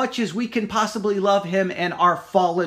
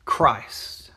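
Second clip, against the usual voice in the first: whispered; 10 semitones lower than usual. Acted. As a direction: breathe the word out as a whisper. Question ends in a whisper.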